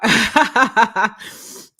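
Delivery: loud burst of laughter; real hearty laugh. Real, not acted.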